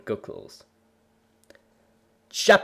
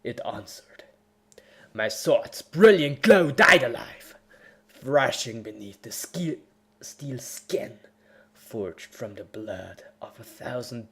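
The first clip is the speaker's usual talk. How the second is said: dramatic story reading; 3 dB quieter than usual. A narrator's reading. Reading his fanfic aloud, overblown epic narration. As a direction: read it like a hammed-up battle saga.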